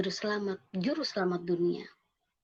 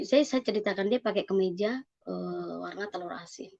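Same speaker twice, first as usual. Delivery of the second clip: ordinary talk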